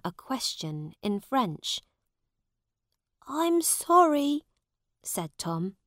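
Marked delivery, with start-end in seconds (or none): 3.3-4.4 put-on higher boy's voice, timid; a nervous boy says sorry; Tom apologising. A narrator's reading.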